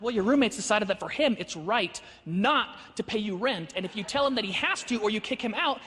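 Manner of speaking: firm argument building to a loud punchline; emphatic, slowing for key words, ending loud; building retort. Retold or imitated. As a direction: lay out the logic, then belt the conclusion.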